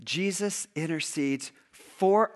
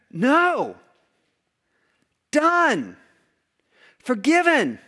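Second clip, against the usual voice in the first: emphatic declaration; 6 semitones higher, 6 dB louder, much slower than usual. Acted. God's firm final answer.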